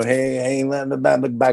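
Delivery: lightly voiced gregarious chatterer; gregarious guy. Shown as a demonstration. Actor illustrating a stock role, lightly gregarious. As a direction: toss out a hearty patter, lightly acted. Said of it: "gregarious, you know".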